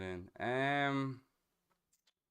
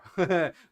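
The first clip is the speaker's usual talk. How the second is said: burst of laughter; 10 semitones higher, 14 dB louder than usual. Real, not acted. Laughs at misheard word.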